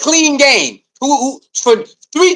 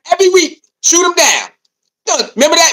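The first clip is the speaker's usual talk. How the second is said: usual speaking voice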